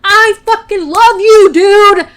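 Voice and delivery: high, loud and slow, yelling the confession; mock-shout it at him in exasperation; frustrated yelled love. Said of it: "it's this thing where it's like".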